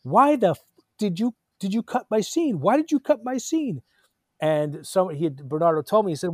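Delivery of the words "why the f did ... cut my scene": retold angry outburst. Actor confronts director at festival, indignant yell. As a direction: demand it like you were robbed. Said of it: "I was so pissed off".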